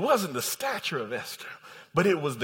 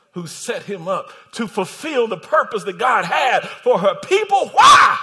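climbing to a shouted climax; fiery pulpit questioning; full-force preaching; 3 semitones higher, 10 dB louder than usual. Real, not acted. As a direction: build in pitch and volume to a final shout.

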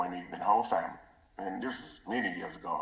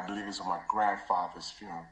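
normal talking voice

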